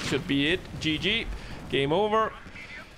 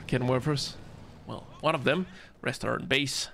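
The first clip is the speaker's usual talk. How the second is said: normal talking voice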